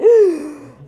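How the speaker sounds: sharp, high gasp of mock outrage; scandalized intake of breath, played up; offended gasp. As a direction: gasp loudly as if deeply affronted. Offended reaction to manager's rebuke.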